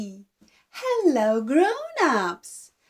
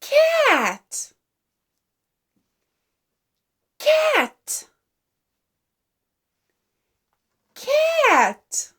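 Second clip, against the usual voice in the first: much higher, slow and clear: Cat; high sing-song word; 9 semitones higher, much slower than usual. Shown as a demonstration. Teacher naming the cat to a baby, very high. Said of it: "What does the cat say".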